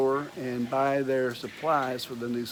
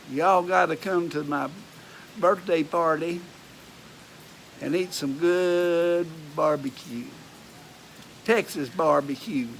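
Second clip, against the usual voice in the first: Texas accent; 3 semitones higher than usual. Shown as a demonstration. Inviting y'all to a birthday barbecue, hammed-up Texas accent. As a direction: play up the Texas twang. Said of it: "that's my Texas accent".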